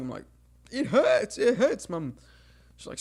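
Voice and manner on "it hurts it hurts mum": put-on high, loud childish wail; mock crying child. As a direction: wail in pain to mum, hammed up. Imitating himself crying as a child.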